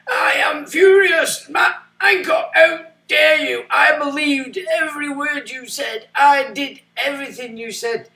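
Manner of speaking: mock a man wailing his outrage, pitched high; furious tearful shout. Mocking a furious guy yelling at Matt Hancock, high and wailing. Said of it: "And he's angry at Matt Hancock".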